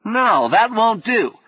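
high, slow fussy protest; drawn-out, perturbed knight. A narrator's reading. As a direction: object as a flustered knight. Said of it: "was growing perturbed".

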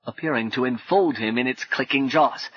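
plain reading voice

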